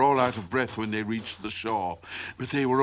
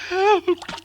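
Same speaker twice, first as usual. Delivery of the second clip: very high chick shriek; cry for help; 3 dB louder than usual. A narrator's reading. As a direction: squeal out in panic. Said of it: "Chirp cried".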